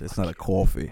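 put-on New York-accented "coffee"; mock New York accent. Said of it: "mine is not a New York accent".

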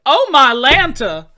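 loud, high, slow drawn out; playful catchphrase. Retold or imitated. Actor saying catchphrase to nervous fan, loud and slow. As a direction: belt it out with a grin. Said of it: "he's just like".

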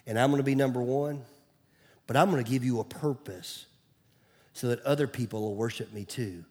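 soft, low, intimate voice of God; God's voice, hushed. Acted. Pastor voicing God's words to his church, hushed and low. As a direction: drop to a near whisper as God speaking. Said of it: "He's saying".

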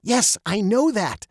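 put-on mildly eager, like a girl who knows; excited. A narrator's reading. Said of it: "Veronica said excitedly".

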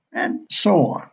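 ordinary talk

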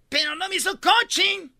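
high, loud mock denial; mock Serena. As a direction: protest in a high indignant voice. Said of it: "y dijo ella".

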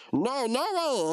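high, drawn-out no; mock refusal. Worker retold refusing the boss, high slow mock refusal. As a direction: refuse slowly, pitched well up. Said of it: "we'd be like".

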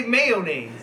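mayonnaise stressed as "MAY-oh-naise"; three-syllable pronunciation. Shown as a demonstration. Friend correcting the other, mayonnaise as "MAY-oh-naise". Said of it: "No, I said man and ace".

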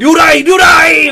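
high, drawn-out cry of alarm; mock panicked battlefield yell; fleeing soldiers' shout. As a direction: shout it like troops running from a feared general. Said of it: "료라이 그러면서 막 도망가요".

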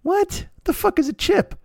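high, stunned disbelief; can't believe the word; shocked, high-pitched what; incredulous. Real, not acted. Outraged reaction to slang.

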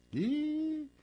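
squeaky surprised ih; a sudden high yelp of surprise; high mock "ih". Exclamação aguda imitada. ("ih" agudo e surpreso)

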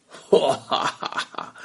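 quiet airy mocking laughter; soft breathy laugh. Tumbler doll laughing softly at the fallen bully. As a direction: laugh at him under your breath. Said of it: "不倒翁还在笑".

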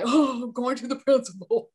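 hammed-up worried goody-goody; mock goody-goody dread. Goody-goody called in by headmistress, mock dread. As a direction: put on a good pupil's dismay. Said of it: "I'm such a goody goody".

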